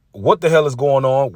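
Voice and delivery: ordinary talk, nothing marked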